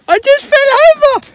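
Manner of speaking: a cartoon squeak of a voice. Vlogger replaying his fall in a chipmunk voice. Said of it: "Where's that from, Ben?".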